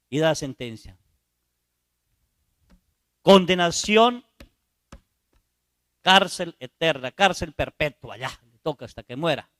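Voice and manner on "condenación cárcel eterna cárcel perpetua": a judge's heavy verdict; solemn sentence. Acted.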